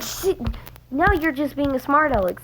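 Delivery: high-pitched mock exasperation; retold scolding.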